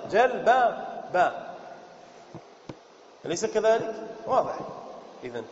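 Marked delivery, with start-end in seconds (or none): 0.1-1.3 جلبب drawn out very slowly, the repeated ب clear; slow demo. Shown as a demonstration. Teacher slowing جلبب to show the doubled ب. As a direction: spell out جلبب slowly, syllable by syllable. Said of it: "تكررت هنا".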